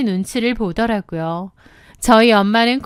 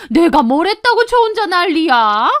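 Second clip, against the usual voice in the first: high-pitched protesting mother-in-law; indignant mother-in-law; 6 semitones higher than usual. A narrator's reading. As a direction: protest your innocence, pitch raised. (억울하다는 듯 높아진 시어머니 목소리)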